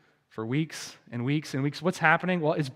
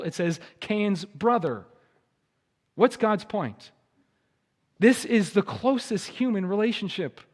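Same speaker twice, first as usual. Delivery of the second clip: ordinary delivery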